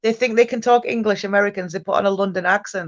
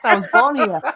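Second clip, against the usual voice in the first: laughing retort; 4 semitones higher than usual. Acted. Mock retort ending in laughter.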